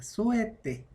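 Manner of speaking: souhaiter said soo-eh-TAY, the h never sounded; silent h in French. Shown as a demonstration. Teacher showing the class souhaiter without the h, clearly. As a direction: model souhaiter with no h at all, just soo-eh-tay. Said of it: "ahí la H no suena".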